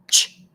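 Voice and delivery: "Ch" as one loud clipped burst; sharp, short CH. Shown as a demonstration. Teacher contrasting CH with SH, "Ch" sharp and short. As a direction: snap out "Ch" and stop at once. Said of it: "is sharp and short".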